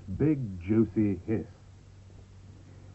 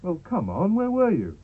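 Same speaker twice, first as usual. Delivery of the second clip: high, louder panto telling-off; mock scolding; 7 semitones higher, 5 dB louder, slower than usual. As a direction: chide the audience like a panto host. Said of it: "I couldn't hear a single hiss".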